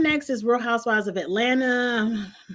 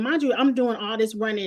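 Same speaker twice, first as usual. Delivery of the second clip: ordinary talk, nothing marked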